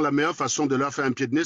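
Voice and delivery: ordinary delivery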